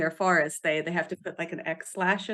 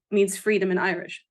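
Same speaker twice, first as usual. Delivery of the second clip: ordinary talk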